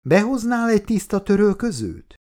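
high-pitched call from another room. A narrator's reading. Wife to husband from the bathroom, calling out. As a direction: call out a request, voice lifted high. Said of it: "kiabált ki a fürdőszobából".

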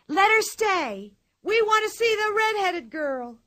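high, loud crowd chant; crowd shouting. Acted. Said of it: "all the people shouted".

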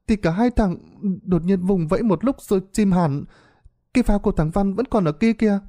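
put-on high, quick child voice telling what happened; a young boy hurriedly explains; child recounting. A narrator's reading. A boy tells the village chief how the two drowned, hurried child voice. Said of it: "Một đứa trong số đó bắt đầu kể".

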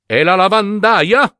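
high, rising exclamation; exclaimed question. A narrator's reading. Judge at the window spotting the washerwoman, exclaiming. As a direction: call it out with pitch climbing. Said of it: "esclamò".

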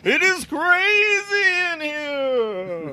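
high, slow ghostly wail; spooky drawn-out words. Pretend. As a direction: drag every word out eerily. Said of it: "Everything is spooky when you draw out the last word".

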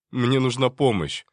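loud panicked exclamation; frightened cry. Acted. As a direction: blurt it out in alarm. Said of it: "воскликнул Енин".

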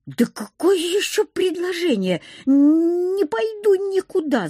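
high-pitched, rising panic; panicked protest. A narrator's reading. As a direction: wail the refusal, stretching it out high. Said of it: "запаниковала я". (паническое возмущение высоким голосом)

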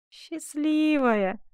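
high, very drawn-out bitter echo; bitter irony. A narrator's reading. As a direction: stretch the word with a bitter smile. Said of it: "перебила его Коломба с горькой улыбкой". (с горькой усмешкой, протяжно)

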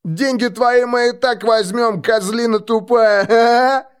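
high, slow jeer breaking into loud laughter; drawn-out thuggish taunt; guffawing mockery. A narrator's reading. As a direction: gloat stupidly, then bray with laughter. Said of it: "заржали оба парня".

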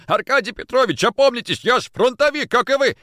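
high, loud pleading; desperate appeal. Acted. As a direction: beg your attacker to come to his senses. Beaten man begs attacker.

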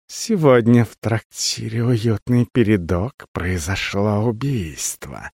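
soft, slow hissing menace; snake-like voice. A narrator's reading. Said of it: "заговорил своим змеиным голосом".

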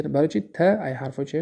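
ordinary talk, nothing marked

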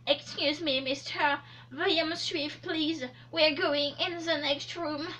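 put-on slow English-accented hairdresser; French with a put-on English accent. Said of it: "C'est quoi cette imitation d'anglais?".

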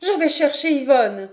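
loud, high cry of inspiration; sudden cry. A narrator's reading. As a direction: cry it out as a last resort. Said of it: "Elle trouva ce cri".

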